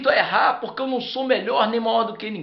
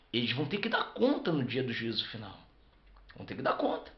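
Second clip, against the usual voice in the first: fading hush; 13 semitones lower, 13 dB quieter, faster than usual. Acted. Sermon warning fading to hush.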